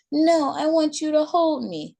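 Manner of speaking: put-on high, small pleading child's voice; a clingy toddler begging to be held; little girl voice. A narrator's reading. Little Maylee to her mom, high whiny child voice.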